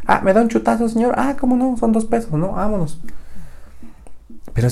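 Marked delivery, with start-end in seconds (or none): 0.1-3.0 mock cartoon voices for the ball and shopkeeper; a silly high-voiced bit; high squeaky voice. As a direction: pitch way up, light and playful.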